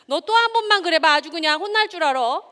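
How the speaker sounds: put-on loud, high threat to a child; threatening scold. Lecturer voicing a parent threatening a child, loud and high. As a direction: bark the warning at a misbehaving kid. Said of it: "뭐 이런 말이에요".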